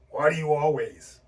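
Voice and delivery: loud, angry accusation, demonstrated; judging, confrontational demo with falling pitch; accusing tone. Shown as a demonstration. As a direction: snap it at them in reproach. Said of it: "I'm using that tone".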